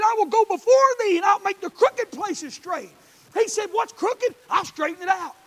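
loud, high, driving preacher's cry; preaching at full force. Acted. Pastor shouting the gospel to his church, full force. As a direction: preach it hard to the congregation. Quoting God while preaching.

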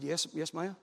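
low, very soft answer; subdued reply. Retold or imitated. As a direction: answer meekly, head hung. Dejected preacher answers the nurse.

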